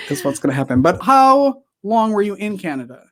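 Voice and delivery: usual speaking voice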